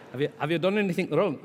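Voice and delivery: put-on high, loud, lousy Scottish accent; hammed-up Scottish questioning; attempted Scottish accent. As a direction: have a bad go at Scottish. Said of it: "retell the story without trying the accent".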